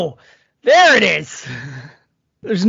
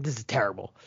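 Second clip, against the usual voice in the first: muttering; 11 semitones lower, 13 dB quieter than usual. Retold or imitated. Retold own reaction, muttered.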